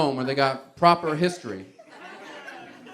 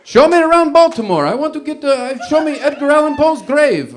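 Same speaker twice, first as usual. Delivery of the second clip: much higher and louder, Count from Sesame Street style Italian; Count-like Italian accent; 11 semitones higher, 10 dB louder than usual. Retold or imitated. Mimicking the Italian guest, loud Count-like Italian accent. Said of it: "sounds like the Count from Sesame Street".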